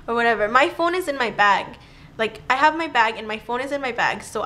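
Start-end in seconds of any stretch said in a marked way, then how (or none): none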